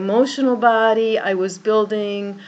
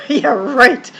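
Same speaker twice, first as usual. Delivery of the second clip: laughing scoff; 11 semitones higher, 6 dB louder than usual. Retold or imitated. Replying to the cleaning woman.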